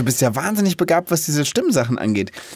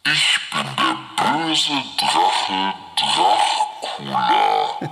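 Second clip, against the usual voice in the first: far lower, demonic growl; devil voice; 9 semitones lower, slower than usual. Shown as a demonstration. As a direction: rumble it like the devil. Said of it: "wie so ein Teufel".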